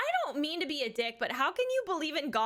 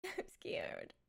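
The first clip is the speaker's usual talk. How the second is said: hushed, much softer whisper of I'm scared; 6 semitones higher, 13 dB quieter than usual. Acted. Streamer to chat, whispering her fear of the Direct. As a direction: breathe it out like a frightened secret.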